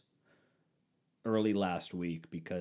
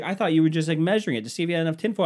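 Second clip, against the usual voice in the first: louder, higher and fast, mock wife; wife defending herself; 8 semitones higher, 8 dB louder, faster than usual. Wife explaining the tinfoil, loud and fast. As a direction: rush her excuse out. Retold argument, wife's line.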